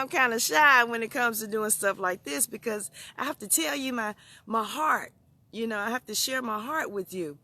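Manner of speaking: normal talking voice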